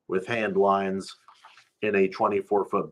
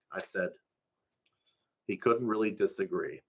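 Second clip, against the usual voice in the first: ordinary delivery, nothing marked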